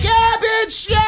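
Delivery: high, shouted taunt; gloating shout, high up; hyped shout. Retold or imitated. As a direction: crow over what you just did. Hyping up after throwing it.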